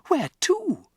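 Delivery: put-on high, worried child's question; desperate to know; anxious girl. Matilda asking her father, high and anxious.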